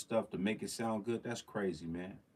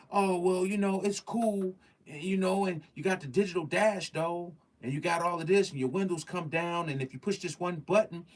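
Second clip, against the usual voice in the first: mock car salesman; 6 semitones higher than usual. Car dealer pitching features to buyer, mock salesman voice. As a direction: sweet-talk like a dealer. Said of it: "they tell you".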